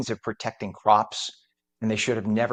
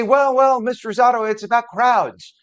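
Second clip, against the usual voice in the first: high, loud mock protest; objecting listener; 12 semitones higher, 8 dB louder than usual. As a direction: pipe up as a whiny-pitched objector. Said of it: "And before you say".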